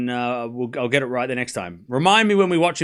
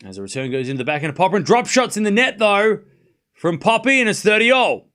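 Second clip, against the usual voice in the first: quick call building higher and louder; live play-by-play; 5 semitones higher, 3 dB louder than usual. Real, not acted. Commentator calls a return and drop shot to viewers, live play-by-play. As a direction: call the rally as it unfolds, rising to the score.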